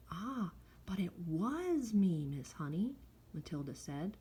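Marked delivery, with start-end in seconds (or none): 0.1-2.9 "was" stretched out with emphasis; slow, emphatic "was"; stress on was. A narrator's reading. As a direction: lean slowly on "was" as if confessing. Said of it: "Was is in italics".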